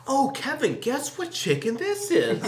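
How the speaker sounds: high, slow teasing mock voice; imitating Steve teasing. Steve asking Kevin about the chicken, high teasing voice. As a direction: lead him on slowly, high and sly. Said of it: "he'd be like".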